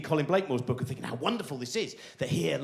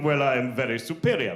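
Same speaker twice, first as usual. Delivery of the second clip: low, self-satisfied chuckle into a boast; put-on pompous smugness, pitched low; smug superiority; 6 semitones lower than usual. Audience member looking down on the others, smug. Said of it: "rest of the audience going".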